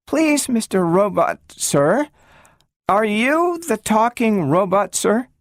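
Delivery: put-on a small girl timidly addressing a big machine; treble little girl. A narrator's reading. Little Gloria politely asks the robot, timid and high. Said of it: "she raised her treble voice".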